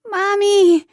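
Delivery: put-on high, loud child's cry of Mommy; little girl exclaiming. Little girl running to her mother, high excited cry. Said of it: "Lily exclaimed".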